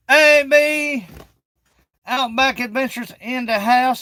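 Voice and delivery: loud, high greeting shout; calling out. Real, not acted. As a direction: hail a friend loudly. Greeting a viewer.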